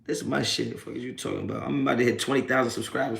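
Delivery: ordinary talk, nothing marked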